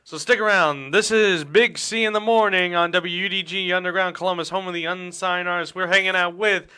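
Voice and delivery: upbeat DJ sign-off to break; radio host announcing. Acted.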